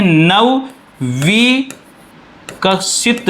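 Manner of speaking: broken into drawn-out pieces; slow dictation. Shown as a demonstration. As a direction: spell it out slowly by syllables. Teacher dictates a new term.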